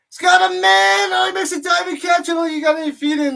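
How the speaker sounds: high, loud and fast as the catch happens; excited shout. Real, not acted. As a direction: yell the play as it unfolds. Big diving catch during play-by-play.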